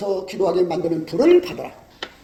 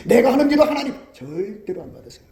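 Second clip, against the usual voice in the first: ordinary talk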